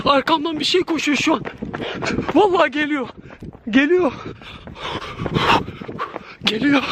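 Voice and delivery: high panicked voice while running; frightened, breathless. Real, not acted. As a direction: gasp it out in fear. Fleeing from something chasing. (korku içinde nefes nefese)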